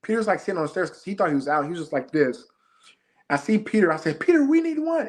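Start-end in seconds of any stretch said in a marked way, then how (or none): 4.2-5.1 high-pitched retold call; urgent calling out. Player calling Peter off the stairs, urgent retold shout. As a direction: summon them fast, voice pitched way up.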